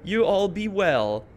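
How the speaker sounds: high, slow, hammed-up noble farewell; mock dramatic. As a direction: mimic a melodramatic self-sacrifice, stretching it out. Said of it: "make a big old dramatic thing".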